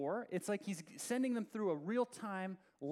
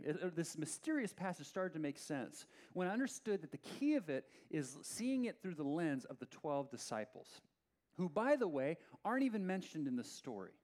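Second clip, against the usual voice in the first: normal talking voice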